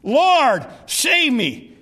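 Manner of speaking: acted desperate calling out; short urgent cry. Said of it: "Short, a short cry, to the point".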